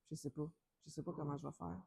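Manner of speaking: fast, soft flustered mutter; muttered aside. Real, not acted. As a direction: mutter it under your breath, rushed. Dogs interrupting the reading. (marmonné rapidement, à voix basse)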